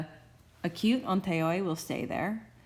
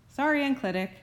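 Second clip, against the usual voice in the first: higher-pitched playful sorry; mock apology; 9 semitones higher, 4 dB louder, faster than usual. Teacher consoling the unaccented enclitic, mock apologetic. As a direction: apologise lightly, in mock sympathy.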